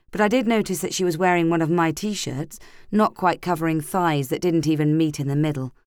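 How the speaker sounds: plain reading voice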